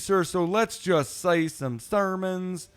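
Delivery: high, slow southern preacher voice; hammed-up southern preacher, drawn out; southern preacher man. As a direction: deliver it like a Southern sermon opening. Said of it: "southern preacher man".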